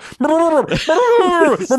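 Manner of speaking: high-pitched garbled burbling yells; wordless mock shouting through a mouthpiece; imitated scuba mumbling. Host imitates two divers communicating underwater, garbled mumbling. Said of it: "these people are mumbling through scuba".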